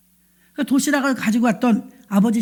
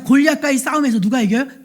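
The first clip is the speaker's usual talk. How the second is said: ordinary talk, nothing marked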